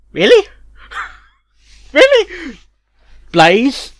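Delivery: high, loud disbelieving questions; incredulous mock outrage. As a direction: scoff at the word in disbelief. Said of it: "I'm like".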